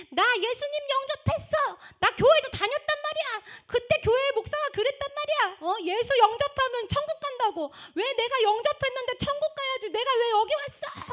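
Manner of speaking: high, loud acted outcry of grievance; screamed protest. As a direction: plead your case in an indignant shriek, as the damned woman. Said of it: "하고 소리를 지르는 거예요".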